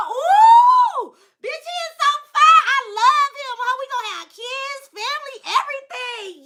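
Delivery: very high, hammed-up gushing; lovestruck squealing. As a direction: squeal it to your best friend. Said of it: "on the phone with your best friend".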